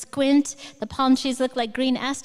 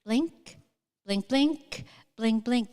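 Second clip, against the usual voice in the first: rhythmic blinking; much slower than usual. Shown as a demonstration. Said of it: "it's like a heartbeat".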